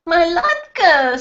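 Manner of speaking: high loud cry of alarm; mother screams for her latke; panicked mother screaming at the TV. A narrator's reading. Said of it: "screamed my mother".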